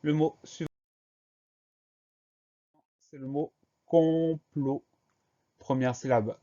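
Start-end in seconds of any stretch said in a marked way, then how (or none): 3.9-4.8 "complot" slowly drawn out in two long syllables; slow, deliberate dictation of "complot". Shown as a demonstration. Teacher sounds out new word.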